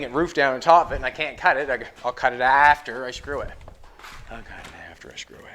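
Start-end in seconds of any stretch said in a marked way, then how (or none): 4.3-5.4 said softer and lower, to himself; quiet mutter to self. Real, not acted. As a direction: repeat the plan under your breath. Repeats plan quietly to himself.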